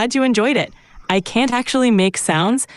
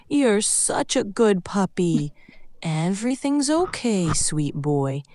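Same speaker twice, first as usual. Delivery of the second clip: slow, soft, tender cooing; soothing; 3 semitones lower, 3 dB quieter, slower than usual. Shown as a demonstration. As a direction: lull a frightened puppy with gentle reassurance. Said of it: "keep doing that to try to soothe him".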